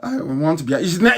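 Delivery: usual speaking voice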